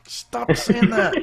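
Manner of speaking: mock exasperated rant. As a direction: laugh through an exasperated outburst. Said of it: "I'm like".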